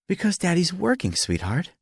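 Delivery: high, gentle-worded motherly explanation; mother to child. A narrator's reading. Wife explains to daughter.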